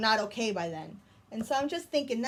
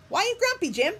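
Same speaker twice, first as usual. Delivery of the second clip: much higher, quizzical voice; high Lemur voice; 11 semitones higher, 5 dB louder, much faster than usual. Acted. Lemur questions Jim, pitched up high. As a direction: ask it up high and curious.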